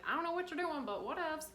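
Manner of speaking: high, airy-casual put-on voice; high-pitched, nonchalant whatever voice; spiritual body's voice. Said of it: "My spiritual body's like".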